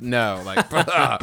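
mock knowing chuckle to yourself; dismissive chuckle. Diner scoffing at the waitstaff's recommendation, mock chuckle. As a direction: chuckle under the breath as if shaking your head. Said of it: "just chuckle to yourself and shake your head".

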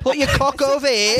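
mock British accent. Continuing mock British bit.

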